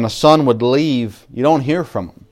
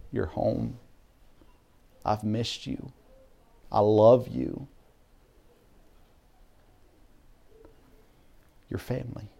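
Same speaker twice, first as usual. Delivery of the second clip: tender welcome; 8 dB quieter than usual. Acted. God welcoming the returning child.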